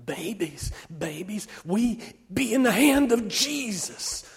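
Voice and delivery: high, impassioned character voice, whispery at moments; fervent promise, fading to a whisper at the end; fierce loving conviction. A narrator's reading. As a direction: preach tenderly and fiercely to the children. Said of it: "with such conviction and with such fierce love".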